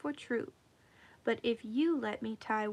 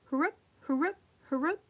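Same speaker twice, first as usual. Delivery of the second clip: straining pull noise; 4 semitones higher, much faster than usual. A narrator's reading.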